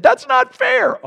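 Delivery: put-on high, loud, drawn-out kid's complaint; whiny child's protest. A child protesting to a strict parent, high whiny shout. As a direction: wail it like a child told no. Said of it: "especially if you said".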